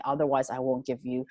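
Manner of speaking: ordinary talk, nothing marked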